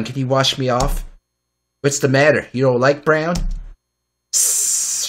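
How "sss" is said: long defensive snake hiss. Acted. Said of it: "she's making snake noises in defense".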